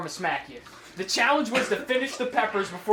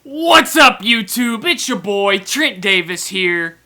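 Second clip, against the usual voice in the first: loud, hyped vlog greeting; YouTuber intro; 10 dB louder than usual. Acted. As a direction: shout out the channel intro.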